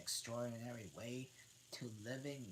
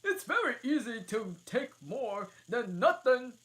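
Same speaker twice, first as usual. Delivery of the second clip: impatient Hatter; 15 dB louder than usual. Acted. Hatter hurrying the Dormouse, high and loud. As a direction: snap at him to hurry.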